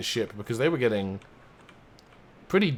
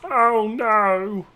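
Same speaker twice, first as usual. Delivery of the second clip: high, loud hammed-up crew pleading; mock panic; 9 semitones higher, 6 dB louder, slower than usual. The gold ship's crew begs the pirates, high loud mock panic. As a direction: squeal like a terrified merchant sailor.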